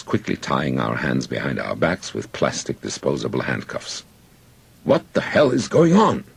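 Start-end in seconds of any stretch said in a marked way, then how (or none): 4.8-6.2 high, loud outraged demand; shouted. A narrator's reading. Captive demanding answers from raiding soldiers, shouted. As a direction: shout it out in protest. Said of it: "I shouted in English".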